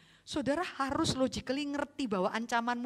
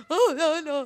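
mock crying child; 6 semitones higher, 8 dB louder than usual. Crying toddler searching parents.

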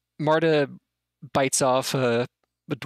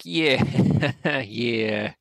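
gleeful goblins. Acted. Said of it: "all the goblins are like".

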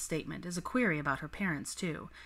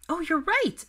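much higher and louder, a startled squeak; 12 semitones higher, 6 dB louder, slower than usual. Acted. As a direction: squeak it out, caught off guard. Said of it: "she squeaked".

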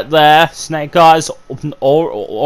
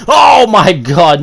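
louder, drawn-out oh my God; stunned slow shout; 4 semitones higher, 5 dB louder, slower than usual. Real, not acted. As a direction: gasp it out as the knockout lands. Big shot knocks wrestler out.

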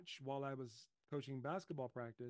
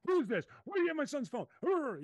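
loud, high furious demand; angry yelling; 12 semitones higher, 11 dB louder than usual. Shown as a demonstration. Dad yelling at the woman holding his son's phone, furious. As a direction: yell at the stranger as if she stole it. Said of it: "instead of that nonsense".

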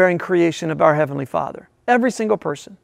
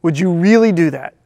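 normal talking voice

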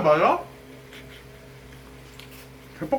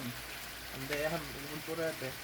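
quiet; 4 semitones lower, 15 dB quieter, slower than usual. Real, not acted. Refusing partner's suggestion quietly.

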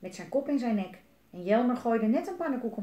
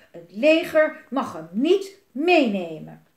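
loud, slow, emphatic defiance; angry; 10 dB louder, slower than usual. A narrator's reading. Boy vows the army won't take the dragon, angry. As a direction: hammer out each word crossly. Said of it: "keek Elise boos".

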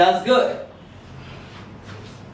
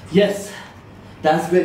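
ordinary talk, nothing marked

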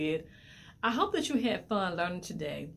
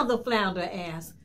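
plain reading voice

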